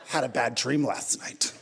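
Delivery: put-on low, sullen accusing girlfriend; sulky girlfriend. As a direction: mutter the grudge over breakfast. Wife voiced, low and sulky.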